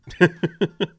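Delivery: a short, high burst of laughter; amused laugh. Real, not acted. Reviewer laughing at his own joke about George, amused burst of laughter.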